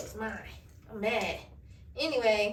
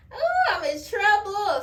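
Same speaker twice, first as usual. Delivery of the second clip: mock dread; 8 semitones higher, 5 dB louder than usual. Realising she already texted him.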